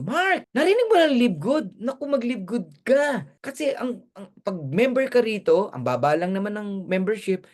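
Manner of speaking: excited, high-pitched sales pitch. Acted. Telling neighbour Mark about Live Good, excited and high. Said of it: "ganun pa yung tono mo, yung excitement mo".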